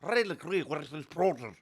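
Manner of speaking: high mocking cartoon mimic; vintage cartoon voice. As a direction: parrot the toon voice. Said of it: "talking like a cartoon character".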